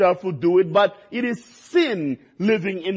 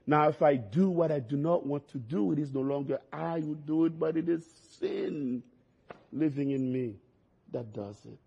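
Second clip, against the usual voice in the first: soft, sinking voice, much softer; hushed reading; 4 semitones lower, 9 dB quieter than usual. Shown as a demonstration. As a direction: let the words fade almost to a murmur. Scripture read twice, then hushed.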